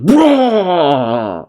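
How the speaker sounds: long, high "braaah" blast noise; imitated blast roar. Fan re-creating a mouth laser blast, roaring.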